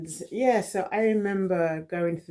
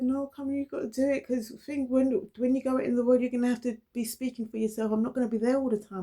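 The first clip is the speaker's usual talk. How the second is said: higher, lecturing big-sister voice; firm sister; 9 semitones higher than usual. Retold or imitated. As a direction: scold him gently but firmly. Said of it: "And I said".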